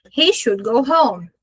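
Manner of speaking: ordinary talk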